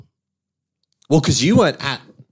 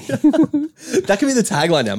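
laughing; 12 semitones higher, slower than usual. Real, not acted. Laughing at the joke tagline.

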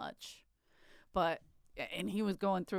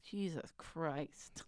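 weary exasperation; 3 semitones lower, 11 dB quieter, much slower than usual. Retold or imitated. Mitzi exasperated at the story.

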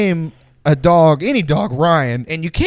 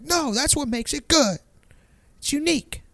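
high, soft mock-kid voice; Jaden; 11 semitones higher, 7 dB quieter than usual. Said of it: "It's like a kid, like a kid logic".

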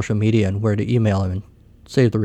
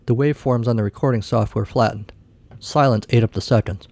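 usual speaking voice

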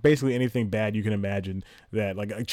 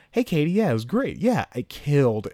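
fake phone call; 5 semitones higher, 3 dB louder than usual. Comic fake-calling his girlfriend after the set, high upbeat voice. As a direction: brag to her in a raised, innocent voice. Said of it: "I do a fake phone call again".

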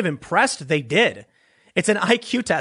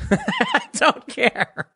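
laughing through a slow, drawn-out "I don't care"; 6 semitones higher, much slower than usual. Real, not acted. Brushing off a friend's advice about jokes, real laughter.

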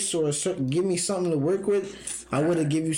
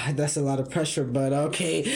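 normal talking voice

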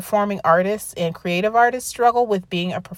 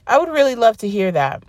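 ordinary delivery

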